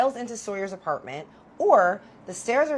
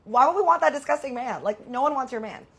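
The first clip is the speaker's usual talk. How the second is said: put-on higher, louder, scoffing girl; incredulous loud retort; 6 semitones higher, 6 dB louder than usual. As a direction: throw it back at her, louder and up high. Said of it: "I'm like".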